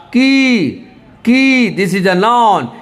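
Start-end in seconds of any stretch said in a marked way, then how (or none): 0.1-1.8 "की" with the ई drawn out long; long vowel. Shown as a demonstration. Teacher showing learners the vowel length, drawn-out "की". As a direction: hold the vowel of "की" well past normal length. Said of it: "लंबा करके बोलना पड़ेगा".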